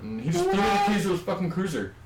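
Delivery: ordinary delivery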